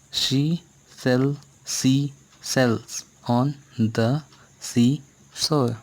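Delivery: she sell sea shells said slowly, word by word; slow tongue twister. Shown as a demonstration. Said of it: "Twist your tongue". (टंग twister धीरे धीरे बोलें)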